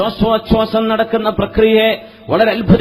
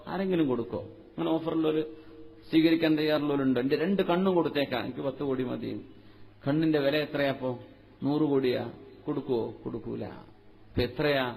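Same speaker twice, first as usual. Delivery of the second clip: much softer, lower intimate asking; hushed questions; 8 semitones lower, 15 dB quieter than usual. Real, not acted. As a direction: lean in and ask almost privately. Preacher drops to quiet questioning. (വളരെ മൃദുവായി താഴ്ന്ന ശബ്ദത്തിൽ ചോദിക്കുന്നു)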